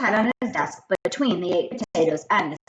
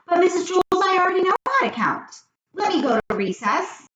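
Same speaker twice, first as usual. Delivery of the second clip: put-on high, eager kid voice; pleading little boy, fast and high; Joe's voice; 13 semitones higher, 4 dB louder, faster than usual. A narrator's reading. Joe begs the teacher to let him go to recess, high eager kid voice.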